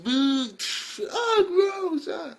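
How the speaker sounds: high, squeaky jeers; heckling old men. Acted. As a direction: boo and gag at the ring.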